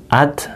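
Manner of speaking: "Ad" with a dead final d; sukun stop sound. Shown as a demonstration. Teacher demonstrating to students, "Ad" with the d killed. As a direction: cut "Ad" off on the d. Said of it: "bunyinya gini".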